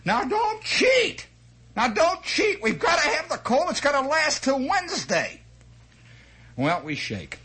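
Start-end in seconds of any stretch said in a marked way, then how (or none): 0.0-5.4 put-on a nagging mother calling down to the kids; mother hollering. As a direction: holler a warning down from the door. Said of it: "you'd hear her holler down".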